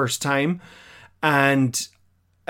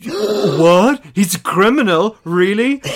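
higher, louder feigned astonishment; gasp, then question it in sham disbelief; mock shock; 5 semitones higher, 4 dB louder, slower than usual. Podcaster feigning surprise at the villain's reveal, mock shocked.

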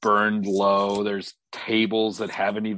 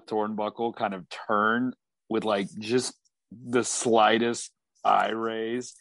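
ordinary talk

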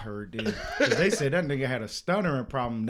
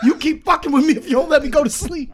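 loud, high-pitched movie threat; mock angry threat; 13 semitones higher, 11 dB louder than usual. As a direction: shout it like a hammed-up warning. Acted threat from movie scene.

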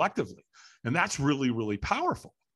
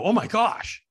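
higher-pitched retold alarm; sudden jolt of dismay; alarmed realisation; 6 semitones higher, 3 dB louder, slower than usual. Firewalker suddenly sure the feet are burnt, alarmed.

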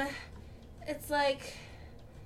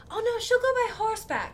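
higher-pitched imitation of a mother; insistent mother; 9 semitones higher than usual. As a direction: play the mother overruling them. Voices the mother in the scene.

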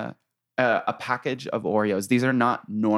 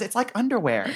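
imitating Missy; 9 semitones higher than usual. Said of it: "She just says".

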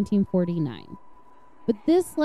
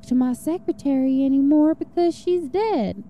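put-on southern accent; 5 semitones higher than usual. Podcaster reading a suspect's bugged remarks, in a mild mock southern accent. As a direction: read the killer's words in a lazy southern accent. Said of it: "I don't know why he has a southern accent".

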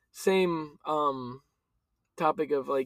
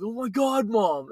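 high-pitched mock exasperation at Mom; mild mock yell, pitch jumping up; exasperated retold yell; 6 semitones higher, slower than usual. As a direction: imitate a fed-up kid complaining to mom. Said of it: "he yelled at her".

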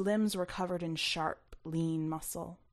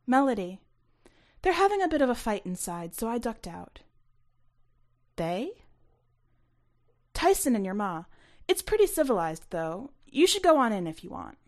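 Melody, breezy stranger; 4 semitones higher, 5 dB louder than usual. A narrator's reading. Narrator voices Melody.

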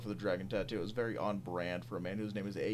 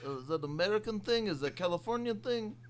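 higher, mock-confused Russian accent; Andre Karolinko Russian accent; 7 semitones higher than usual. Asked by cohost, mock Russian baffled after dunk. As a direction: play a baffled Russian big man in a hammed-up accent. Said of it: "can you do an impression of Andre Karolinko".

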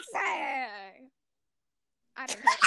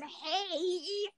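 squeaky gleeful gremlin giggle; 5 semitones higher, 7 dB quieter than usual. Retold or imitated. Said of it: "I sound like a little gremlin".

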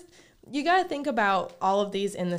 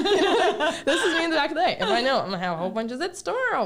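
laughing; 9 semitones higher, 7 dB louder, faster than usual. Real, not acted. Laughing while talking.

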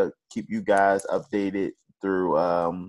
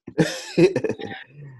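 breathy laugh. Real, not acted. Cohost laughing at joke.

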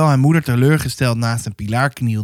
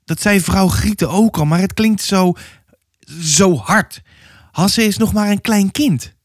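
a hint of a flustered, slightly higher mother; 7 semitones higher than usual. Mother defending her baby to the neighbour, lightly voiced and unsure. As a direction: stammer like an unsure young mother.

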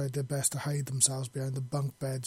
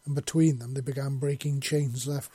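usual speaking voice

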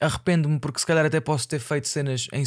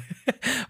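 nervous laugh; 8 semitones higher, 6 dB quieter than usual. Real, not acted. Laughs at fear of messing up.

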